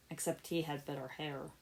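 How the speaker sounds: sarcastic mutter. Acted. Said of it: "Lloyd muttered sarcastically".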